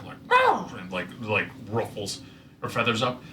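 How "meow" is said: shrill startled bird squawk, very high; horrified squawk. Acted. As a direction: squawk it out in alarm. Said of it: "a horrified squawk".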